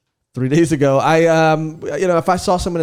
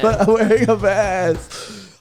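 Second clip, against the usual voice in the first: very slow, drawn-out comic insistence; playfully stubborn, dragged-out repeat; insistent protest; 3 semitones higher, much slower than usual. Real, not acted. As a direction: stretch out every word of the complaint. Repeats protest after being mocked.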